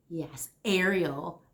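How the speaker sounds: ordinary delivery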